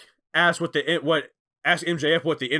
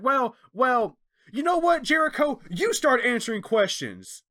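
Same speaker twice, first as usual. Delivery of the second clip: mock taunt; 8 semitones higher than usual. MJF taunting Jericho.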